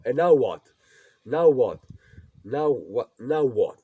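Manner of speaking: loud, exasperated repeated now what; frustrated shout. Real, not acted. Young man ranting at the camera, loud and exasperated. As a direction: throw the question out in real frustration.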